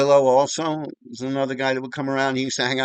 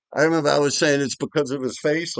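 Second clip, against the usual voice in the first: normal talking voice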